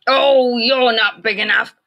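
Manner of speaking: loud high scoffing; grouchy ladybug voice. A narrator's reading. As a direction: scoff it loudly. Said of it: "said the grouchy ladybug".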